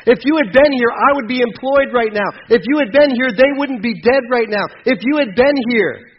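loud, high, pleading cry to God; anguished plea. Acted. Grieving believer crying out to God, loud and pleading.

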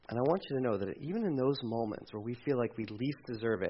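usual speaking voice

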